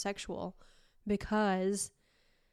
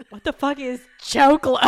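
louder, puzzled line cooks; confused disbelief; 4 semitones higher, 11 dB louder than usual. Retold or imitated. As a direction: bark it in baffled disbelief. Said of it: "they're like".

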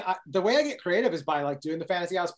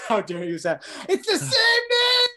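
high, slow, whiny mock protest; a high drawn-out fake complaint about his own name; mock indignant; 3 dB louder, slower than usual. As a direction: stretch it out in a high put-on whine.

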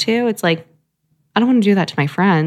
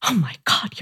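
whispered quote; 4 dB quieter than usual. Retold or imitated. Retold reaction, whispered.

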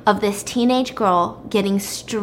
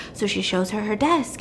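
normal talking voice

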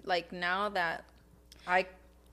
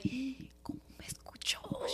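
slow, hushed mom whisper; imitated alarmed whisper; 3 semitones higher, 13 dB quieter, slower than usual. Daughter imitates mom realizing dad heard her, whispering. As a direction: whisper it so dad cannot hear.

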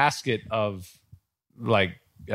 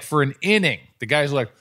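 normal talking voice

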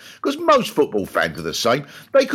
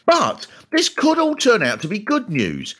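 normal talking voice

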